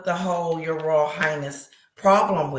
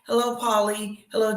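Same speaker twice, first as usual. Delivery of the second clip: usual speaking voice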